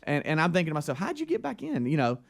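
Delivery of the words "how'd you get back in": much higher, soft baffled question; a bewildered inner voice; baffled thought. Retold or imitated. Speaker retells his puzzled thought about the intruder, voice pitched way up and soft.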